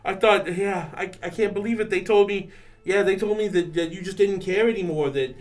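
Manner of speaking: emotional excitement. Acted. Character reunion, emotional.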